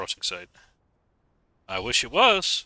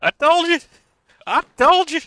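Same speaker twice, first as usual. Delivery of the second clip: gloating; 8 dB louder than usual. Real, not acted. Gloating after players drown.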